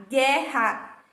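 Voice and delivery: ordinary talk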